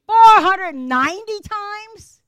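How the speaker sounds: loud, high and slow disbelief; shocked outburst. Acted. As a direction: cry out as if the number floors you. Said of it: "The human part of Peter went".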